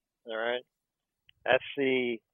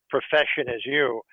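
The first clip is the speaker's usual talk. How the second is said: usual speaking voice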